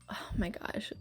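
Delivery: soft breathless whisper; whispered aside. Real, not acted. Exasperated whisper to viewers about the guy. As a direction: drop to a whisper in disbelief.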